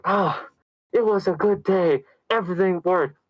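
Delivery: high, hammed-up contented sigh of relief; mock happy relief. Voices the relieved happy ending.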